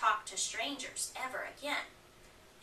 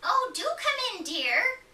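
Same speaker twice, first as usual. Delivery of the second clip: put-on much higher, sweet old granny voice; welcoming granny; 11 semitones higher, 6 dB louder than usual. Granny welcoming her grandchild in, high and sweet. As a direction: invite him in high and kindly. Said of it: "said Granny".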